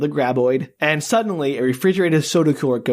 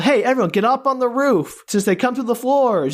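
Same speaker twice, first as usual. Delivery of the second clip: higher-pitched calling voice; mock yell; 7 semitones higher than usual. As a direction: imitate calling out to the townspeople. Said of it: "they yell at the other townspeople".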